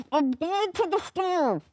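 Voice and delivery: muffled, slow, through shut lips; like calling from inside a closet; muffled closed-mouth voice. Shown as a demonstration.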